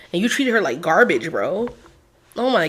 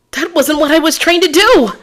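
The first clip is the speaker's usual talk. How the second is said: higher, louder indignant cry; loud protest; 10 semitones higher, 6 dB louder than usual. Acted. Defense lawyer objects loudly to the prosecutor's accusation.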